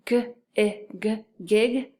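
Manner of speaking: "g, i, g" separated, then "gig"; tapping out sounds. Shown as a demonstration. Teacher putting the first part together, sound by sound. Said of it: "put it together with the first part".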